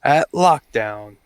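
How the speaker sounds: long drawn-out name announcement; ring announcer. Pretend. Announcing Kenny as the winner moving on, drawn-out ring announcer. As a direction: stretch the winner's name out slowly.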